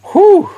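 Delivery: high, loud whew from the burn; real pain exhale after a super hot pepper; sharp burning exhale. Real, not acted. Reacting to pepper heat.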